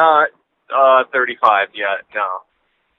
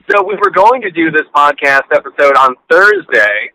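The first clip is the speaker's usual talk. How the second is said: usual speaking voice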